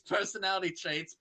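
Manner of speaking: ordinary delivery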